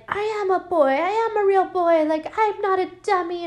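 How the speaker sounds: high, indignant dummy voice; pissed-off puppet. Acted. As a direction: protest in a high put-on puppet voice. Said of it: "Billy's getting pissed".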